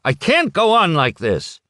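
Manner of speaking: put-on old man fed up with the interruptions; angry outburst. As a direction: slam it down with the words. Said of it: "angrily slammed his glass down".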